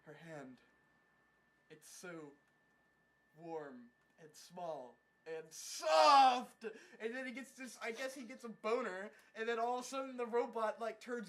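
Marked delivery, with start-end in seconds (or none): 0.1-5.4 low, soft, halting reverie; mock tender awe. Hero feels her hand on his chest, mock tender awe. Acts out smitten hero's reverie. 5.5-6.6 sudden loud, drawn-out high cry; mock rapturous outburst. Hero overcome by her touch, mock rapturous cry.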